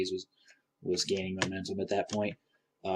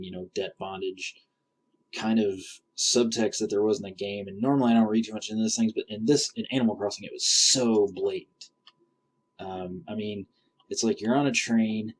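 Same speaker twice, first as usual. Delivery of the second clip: normal talking voice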